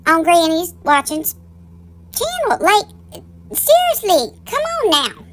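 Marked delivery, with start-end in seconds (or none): 2.1-5.2 high disbelieving come on now; incredulous. Real, not acted. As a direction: sound amazed and fed up. Incredulous at member joining.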